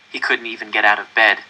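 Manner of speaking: usual speaking voice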